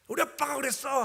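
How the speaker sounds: put-on high, loud proud kid's voice; confident child. Son before everyone, confident high child voice. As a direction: say it boldly like a sure little boy. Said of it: "일말의 의심도 없이".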